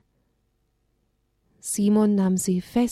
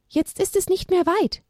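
owl calling down from the sky, higher voice; 11 semitones higher than usual. Acted. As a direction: call out like a kind owl overhead. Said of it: "rief er ihnen aus den Lüften zu". (Eule ruft von oben, höhere Stimme)

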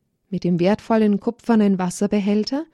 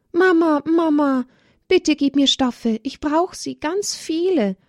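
put-on high, begging child's voice calling mama; little girl begging her mother for fabric; pleading little girl; 8 semitones higher than usual. A narrator's reading. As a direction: plead with mother, higher and eager. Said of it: "bettelte Olivia innig bei der Mutter".

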